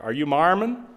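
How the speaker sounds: imitating the Utah local's lilt, rising at the end; mock the way folks out there ask it. Preacher imitating a Utah man at the door, local way of asking. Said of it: "That's the way they would say it out there".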